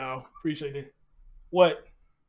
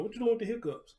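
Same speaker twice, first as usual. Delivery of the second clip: higher cooing pet voice; talking to a puppy; 6 semitones higher, 4 dB quieter than usual. Acted. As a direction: coo at the puppy. Baby talk to hiccuping puppy.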